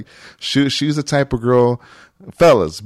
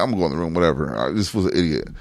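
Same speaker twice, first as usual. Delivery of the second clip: low mutter; 7 semitones lower, 5 dB quieter, faster than usual. Retold or imitated. Narrator storming off to his room, low grumble. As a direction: lower and softer, muttering.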